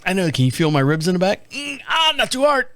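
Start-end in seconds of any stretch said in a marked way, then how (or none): 1.5-2.7 high, strained little yelp; mock pained wince. Hugged on sore ribs, playfully pained. Pretends hug hurts his ribs.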